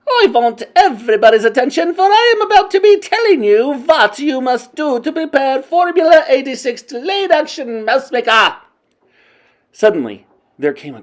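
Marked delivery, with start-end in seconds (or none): 0.1-8.6 loud, high, foreign-tinged witch voice; commanding witch queen. A narrator's reading. Grand High Witch instructing her witches, loud commanding voice. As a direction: rule the room like a tyrant.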